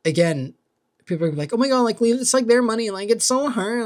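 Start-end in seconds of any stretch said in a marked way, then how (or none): 1.5-3.9 high, louder sarcastic critic voice; mock whiny critic. Vlogger mocking viewers who defend the old man, high and whiny. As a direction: parody the people scolding him, pitched up.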